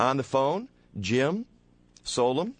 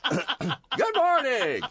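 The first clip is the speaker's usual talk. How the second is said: high, jokey radio greeting; hammed-up announcer; 12 semitones higher than usual. Host greets listeners home from church, hammy and high. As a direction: greet the church crowd grandly.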